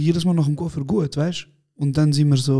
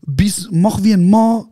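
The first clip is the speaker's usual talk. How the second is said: mock tough shout; 5 semitones higher, 6 dB louder, slower than usual. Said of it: "er so".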